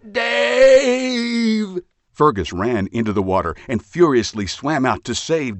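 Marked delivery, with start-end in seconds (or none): 0.1-1.8 loud, much higher cry. A narrator's reading. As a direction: shout the name in alarm. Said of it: "he cried".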